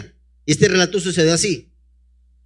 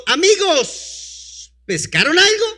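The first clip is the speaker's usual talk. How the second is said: voicing Jesus calling out; 9 semitones higher, much slower than usual. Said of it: "Y les preguntó".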